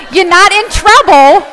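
loud, high joking announcement; mock scolding. Teacher joking with audience about a misheard word, loud mock scolding. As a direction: call it out loudly in pretend reproach.

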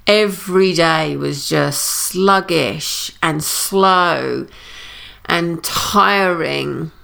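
very slow, dragging delivery; sluggish and slow. Real, not acted. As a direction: drag the words out wearily. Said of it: "my body is mimicking it".

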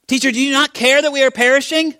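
high, pleading disciple; acted cry to wake the teacher; alarmed plea. Disciples wake Jesus in panic.

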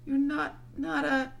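put-on high, faltering girl's voice; falter, afraid to finish; shaky, broken-off question. A narrator's reading. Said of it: "asked Susan in a shaky voice".

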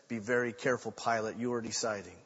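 low, soft and grave; quiet warning. Retold or imitated. Jesus's calm warning to Pilate.

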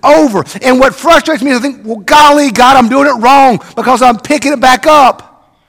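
loud, ringing preacher's call from the pulpit; full-force preaching. Acted. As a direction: preach it out big to the whole church.

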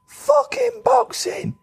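high, slow, drawn-out scream of grief; anguished scream. Retold or imitated. Girlfriend learning her boxer died, anguished scream. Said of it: "she just screams at the top of her lungs".

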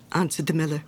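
ordinary delivery, nothing marked

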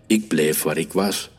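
ordinary delivery, nothing marked

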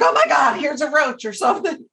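high, mock-startled cry of a roach; mock alarm. Friend pranking her in a hotel room, mock alarm. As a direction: fake a sudden scare to prank her. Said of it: "And he's like".

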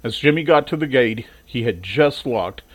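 ordinary delivery, nothing marked

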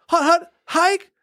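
high, slow hut hut hike; snap count call. Pretend. Injured quarterback calling the snap again, high and slow. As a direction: shout the snap, strained.

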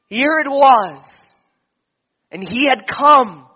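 swelling sermon climax; rising preaching fervor. Acted. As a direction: preach it at full force, slowing on the peak.

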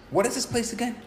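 high-pitched imitated query; puzzled. Stranger returns to ask about the place, imitated puzzled query. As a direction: raise the whole question in retold puzzlement. Retold stranger asks once more.

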